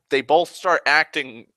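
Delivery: usual speaking voice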